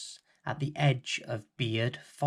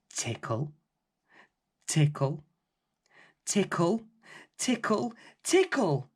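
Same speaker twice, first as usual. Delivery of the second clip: rising tickles; 5 semitones higher than usual. A narrator's reading. The giant's nose tickling, rising higher with each tickle. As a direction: build the tickles up the scale to a squeak.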